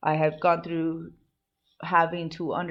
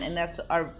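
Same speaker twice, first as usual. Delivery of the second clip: ordinary delivery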